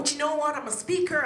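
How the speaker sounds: usual speaking voice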